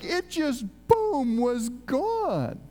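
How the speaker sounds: high, with a punched, drawn-out boom; amazed exclamation. Acted. Preacher on the body suddenly vanishing, amazed. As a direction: burst out on boom, marvelling.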